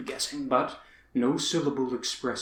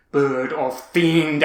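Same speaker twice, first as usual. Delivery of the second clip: slow, much louder, higher cry; shriek; 3 semitones higher, 13 dB louder, slower than usual. Acted. Man shrieks at the raven as he starts up. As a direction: hurl the words at the bird. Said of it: "I shrieked".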